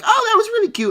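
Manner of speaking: high, mock gushing voice; mock girl gushing. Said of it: "girl was like".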